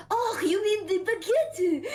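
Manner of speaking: baguette high, slow, with exaggerated French sounds; imitated French correction. French friend correcting the bread word, baguette in high mock French. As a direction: exclaim baguette as a French caricature, drawn out. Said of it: "And she's like".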